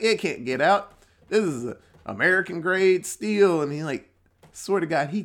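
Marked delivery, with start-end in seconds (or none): 0.0-3.6 confident boast. Acted. Old guy answers, acted reassurance.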